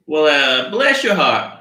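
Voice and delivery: high, loud fake Southern twang; Southern accent. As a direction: have a clumsy go at a Southern accent. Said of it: "I can't do accents".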